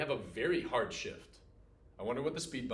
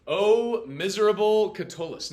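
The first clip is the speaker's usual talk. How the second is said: higher, louder mock-tragic cry; dramatic lament; 7 semitones higher, 10 dB louder than usual. Teacher voices Catullus addressing himself, mock-tragic. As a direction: wail it like a lovelorn poet.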